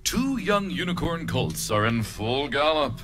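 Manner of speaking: usual speaking voice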